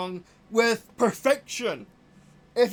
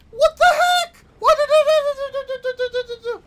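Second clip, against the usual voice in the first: very high shriek, last word stretched out; frustrated screaming; 13 semitones higher, 9 dB louder, much slower than usual. Real, not acted. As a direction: scream it, then hold the wail. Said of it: "Sorry that I'm yelling".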